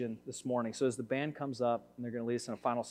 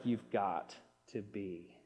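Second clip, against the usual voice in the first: slow, hushed exasperation; quietly seethe, dragging out each word; ticked-off disbelief; 4 semitones lower, 9 dB quieter than usual. Retold or imitated. Husband balking at his wife's plan to stop and help, quiet exasperation. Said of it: "I'm so ticked".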